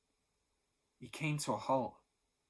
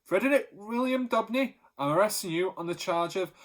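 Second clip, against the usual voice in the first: much louder and higher, a formal call; loud official voice; 7 semitones higher, 7 dB louder than usual. A narrator's reading. Policeman at the door calling Pa, loud official voice. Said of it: "a loud official voice".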